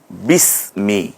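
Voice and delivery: বিসমি stretched very slowly and softly; slow model word. Shown as a demonstration. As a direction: draw out বিসমি for learners. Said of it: "আমরা যে বিসমি বলি".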